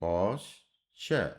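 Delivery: पॉस्चर drawn out slowly; slow spelled word. Shown as a demonstration. Teacher writing the outline for students, saying पॉस्चर slowly. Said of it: "ऐसे".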